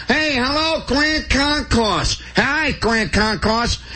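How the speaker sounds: high, very slow sing-song holler; hollered greeting. Retold or imitated. Model in a Florida ad jumps up and hollers to the Bronx, high and slow. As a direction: stretch it out like a cheesy ad extra. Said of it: "somebody jumps up and hollers".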